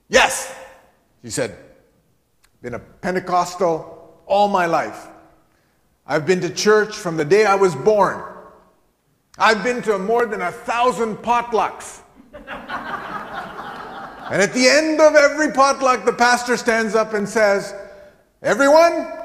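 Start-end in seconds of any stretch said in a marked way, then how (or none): 0.1-0.5 put-on high, loud, emphatic German-accented reply; German-accented old parishioner. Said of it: "She had a very thick German accent". 2.6-17.7 put-on loud, high German-accented testimony, building; thick German accent. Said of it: "She had a very thick German accent".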